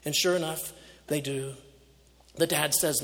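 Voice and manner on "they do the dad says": ordinary talk, nothing marked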